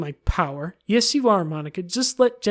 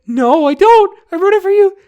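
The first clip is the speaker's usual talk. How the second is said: much louder and higher shout; 13 semitones higher, 13 dB louder than usual. Acted. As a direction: yell it across the room. Shouted reply to question.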